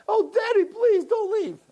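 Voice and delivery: put-on high, wailing child voice; pleading little boy. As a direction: imitate a tearful kid begging. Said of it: "the little boy stands in the doorway".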